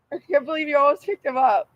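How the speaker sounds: ordinary talk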